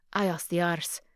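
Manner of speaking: normal talking voice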